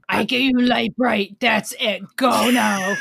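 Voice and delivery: growling bugbear. Pretend. Zombie Santa shooing kid.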